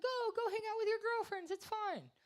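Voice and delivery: very high, sing-song permissive voice; airy cheerful approval; mock sweet approval. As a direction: wave her off sweetly. Mocking women's easy acceptance.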